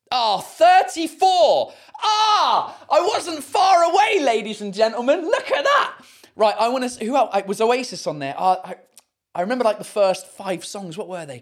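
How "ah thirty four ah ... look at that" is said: high, loud triumphant shouting; exultant, drawn-out cheering. Real, not acted. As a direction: crow loudly over a near-perfect guess. Celebrates a near-correct guess.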